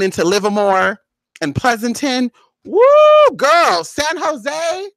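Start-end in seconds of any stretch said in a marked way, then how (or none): 2.6-3.4 high loud whoop; woo of dismay. Real, not acted. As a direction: let out a big dismayed whoop. Stuck in traffic, exclaiming.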